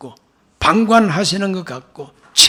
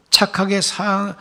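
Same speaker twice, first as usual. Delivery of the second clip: normal talking voice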